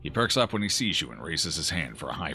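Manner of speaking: usual speaking voice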